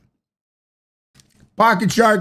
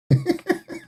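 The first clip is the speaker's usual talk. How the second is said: high laugh; 6 dB quieter than usual. Real, not acted. Laughs at TikTok.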